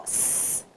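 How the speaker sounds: "s" as a long voiceless hiss, no vowel; sustained S sound. Shown as a demonstration. Teacher hints the first letter to pupils, sustained S. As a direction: hold the bare sound of "s". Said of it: "Qual letrinha começa o sapo".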